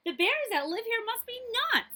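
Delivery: put-on high, loud exasperated girl. Goldilocks fed up with the giant beds, squeaking loudly. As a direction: squeak out her disbelief at the huge beds.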